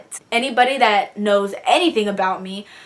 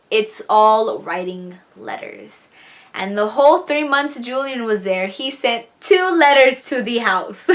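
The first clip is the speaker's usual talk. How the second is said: normal talking voice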